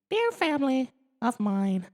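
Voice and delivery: very high alien voice; Alf's note. Acted. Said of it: "she read".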